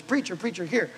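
a hint of a grateful daughter hurrying; eager daughter. The daughter chases the pastor with five dollars, calling out eagerly. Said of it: "And she goes".

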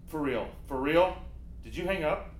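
ordinary talk

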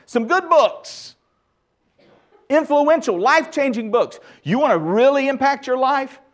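fired-up pastor pushing the church to read; full-force preaching. Acted. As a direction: drive the challenge home from the pulpit.

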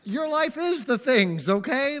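high, drawn-out comic disbelief; mock exasperation. Preacher answering Jesus' feigned ignorance on the Emmaus road, exasperated. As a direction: stretch each word in high-pitched playful scolding.

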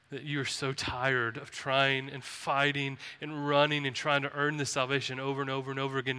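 normal talking voice

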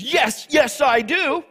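high, loud self-justifying protest; indignant Jonah. Acted. Jonah justifying himself to God, loud and indignant. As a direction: whine it like you've been cheated. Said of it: "Jonah, trying to justify himself".

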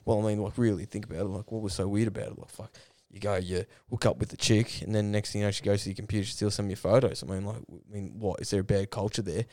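low, soft mumbling imitation of a footballer; mumbled quote. Imitating a footballer's interview answer, soft and mumbled. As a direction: mumble it under your breath like a dodgy interview answer. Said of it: "He's like".

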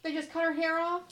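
high, loud shocked question; shocked disbelief. Real, not acted. Cohost hearing the haircut story, shocked and high. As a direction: gasp it out in disbelief.

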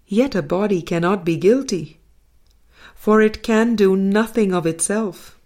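usual speaking voice

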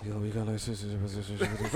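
low, hushed gibberish mumble; imitated unintelligible whisper. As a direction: mimic him trailing into soft, low babble. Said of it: "I don't know what you saying".

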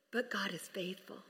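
soft unvoiced whisper; whispered emphasis. Real, not acted. Pastor hushing the congregation on eternal life, whispering. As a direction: hush it like a secret.

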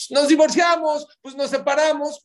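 high, loud mock voice of a careless spouse; a spouse tossing off divorce talk, high mock voice; mock quote. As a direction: ham up a flippant spouse. Mocking careless couples' talk.

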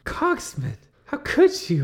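how could you, stunned; shocked betrayal. Acted. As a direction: player character cries out at the traitor. Betrayed player cries out.

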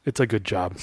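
low and soft, almost under the breath; quiet. Retold or imitated. Robert quietly answering his boss to placate him, soft and low. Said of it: "Robert just quietly goes".

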